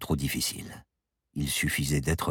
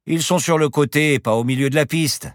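loud, higher, confident sales patter; unruffled boastful pilot; 11 semitones higher, 8 dB louder than usual. Acted. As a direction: sell the landing strip, waving away all danger. Pilot brushes off passenger's fear.

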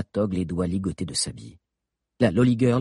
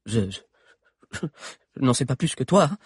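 stammering; 6 semitones higher than usual. Acted. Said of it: "balbutia Valère".

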